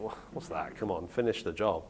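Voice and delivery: half-voiced annoyance at a missing ending; mildly annoyed. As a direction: mutter it, lightly irritated. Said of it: "I just get annoyed".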